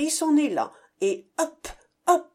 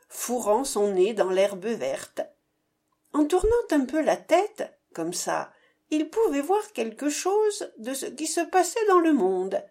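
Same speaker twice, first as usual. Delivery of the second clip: ordinary delivery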